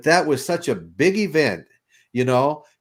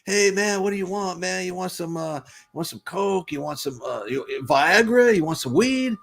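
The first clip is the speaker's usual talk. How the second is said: high, eager street-seller voice; beach hustler; 8 semitones higher than usual. Retold or imitated. Beach dealer hawking drugs to a tourist, high and eager. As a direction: hawk drugs at a passing tourist.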